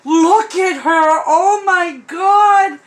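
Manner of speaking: overwhelmed with happy tears; tearful joy. Real, not acted. First sight of the doll.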